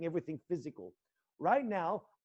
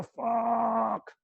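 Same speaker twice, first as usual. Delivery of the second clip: loud, higher burst of rage; yelled curse; 6 semitones higher, 7 dB louder than usual. Shown as a demonstration. Son grabbing the parent's hair, a loud high furious yell. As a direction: explode on the word. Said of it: "you grab this person by the hair and just go".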